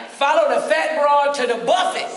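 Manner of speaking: high, loud boozy call-out; mock drunk shouting across the room; tipsy party voice. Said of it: "I'm like".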